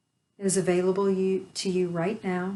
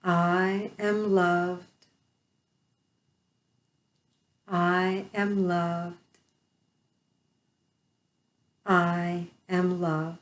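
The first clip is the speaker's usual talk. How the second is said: slow affirmation; slower than usual. Shown as a demonstration. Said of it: "I will say them slowly".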